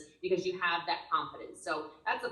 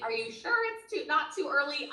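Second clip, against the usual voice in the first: high-pitched doubting listener; mock doubt; 8 semitones higher than usual. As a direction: pipe up as an unsure objector. Voices a doubting listener's question.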